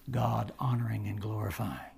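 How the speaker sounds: reverent hushed whisper. Real, not acted. Preacher to congregation, whispering in reverence. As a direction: breathe it out in awe.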